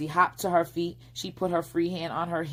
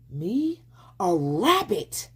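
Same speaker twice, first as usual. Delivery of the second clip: higher, slow indignant; offended surprise; 4 semitones higher, 3 dB louder, slower than usual. A narrator's reading. Fox offended at being called a rabbit, indignant. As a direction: take offense at the word rabbit.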